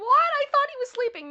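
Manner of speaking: high, loud mock surprise; voicing a viewer's surprised comment. Chat viewer surprised the dog is awake, high loud mock surprise. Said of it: "Pearl Jam's like".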